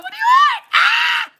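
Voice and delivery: shrieked, very high and loud, drawn out; screaming shout. Pretend. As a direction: yell it at the top of your lungs. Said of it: "That's how I would be that day".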